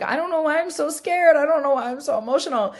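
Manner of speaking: retold overwhelmed confession, pitched high; bewildered distress. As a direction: imitate being scared and confused, voice lifted. Retelling her tearful confession to masseuse.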